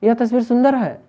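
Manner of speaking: high-pitched wonder; surprise. Shown as a demonstration. Teacher showing what an exclamation is, surprised. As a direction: marvel aloud, falling at the end. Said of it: "ये आश्चर्य हो गया".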